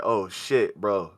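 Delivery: low, hushed dread; understated panic, kept quiet and low; retold panic. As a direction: mutter it softly to yourself. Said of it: "I started getting a panic attack".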